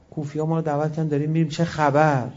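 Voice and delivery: usual speaking voice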